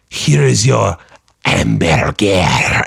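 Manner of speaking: exaggerated French accent, "hamburger" very drawn out. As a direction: play a slow, over-the-top French waiter. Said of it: "if a Frenchman came over".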